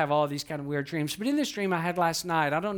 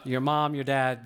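ordinary talk

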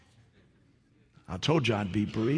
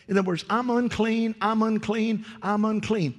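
higher, louder fiery preaching; preaching at full force; 9 semitones higher, 5 dB louder than usual. Acted. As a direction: preach it with all your fire to the congregation.

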